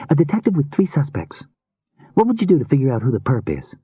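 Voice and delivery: put-on high, girlish voice, probing; inquisitive young woman. A narrator's reading. Rune begins questioning Sam about suspects, inquisitive young woman. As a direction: pitch it high, fishing casually for information.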